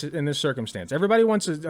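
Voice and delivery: usual speaking voice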